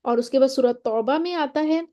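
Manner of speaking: ordinary talk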